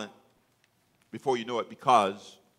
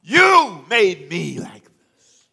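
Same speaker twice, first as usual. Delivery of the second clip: slow bitter accusation; 5 semitones higher, 12 dB louder, much slower than usual. Acted. Voiced bitter grievance.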